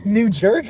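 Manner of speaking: New Jerk, said as "New Jerk" with a high laughing rise; jokingly twist New Jersey into "New Jerk"; mispronounced New Jersey. Shown as a demonstration. Joking to viewers about New Jersey, high amused "New Jerk". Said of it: "New Jerk and New Jersey".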